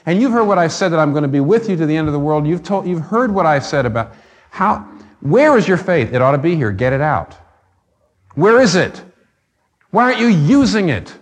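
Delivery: high, loud, urgent rebuke building to a shout; rising rebuke. Retold or imitated. Jesus rebuking the frightened disciples, rising loud and high. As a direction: press them harder with each question.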